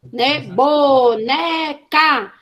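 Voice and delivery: "boneca" drawn out very slowly, sound by sound; very slow "boneca", split into phonemes for a class; segmenting into phonemes. Shown as a demonstration. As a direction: stretch "boneca" apart into its separate sounds. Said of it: "eu tô dividindo em fonemas".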